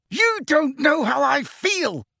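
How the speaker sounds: high, slow shouted outburst; roaring. A narrator's reading. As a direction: shout it out in anguish. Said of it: "Harry roared".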